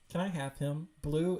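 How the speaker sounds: ordinary talk, nothing marked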